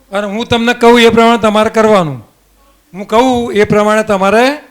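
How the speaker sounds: loud, emphatic classroom instruction; projecting to a class. Real, not acted. Teacher tells children to keep eyes closed, loud and instructive. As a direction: address the whole room firmly and loudly.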